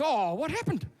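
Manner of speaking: a hint of a worried dad's question; concerned father. Father asking his upset daughter, lightly acted concern. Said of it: "Mike goes".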